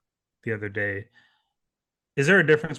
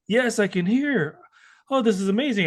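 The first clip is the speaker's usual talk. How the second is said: amazed; 7 semitones higher than usual. Retold or imitated. Retelling a man's first moment of hearing, amazed. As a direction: imitate someone thrilled to be hearing.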